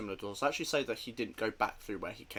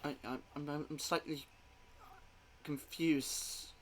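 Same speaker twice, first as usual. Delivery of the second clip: higher, halting, bewildered; confused stammer; 6 semitones higher than usual. Acted. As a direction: stumble over words, uneasy.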